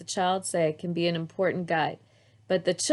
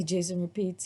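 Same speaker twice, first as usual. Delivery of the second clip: usual speaking voice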